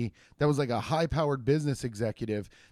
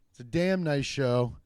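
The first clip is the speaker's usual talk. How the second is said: ordinary talk, nothing marked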